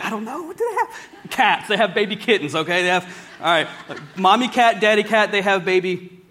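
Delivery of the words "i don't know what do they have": high, laughing mock voice of someone confused; mock confused listener. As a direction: play a baffled person, squeaking up high through a laugh. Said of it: "Some people are like".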